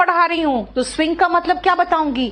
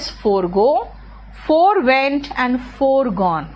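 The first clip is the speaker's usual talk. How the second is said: forego, forewent, foregone said very slowly; slow verb forms; much slower than usual. Shown as a demonstration. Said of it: "फोर मतलब पहले".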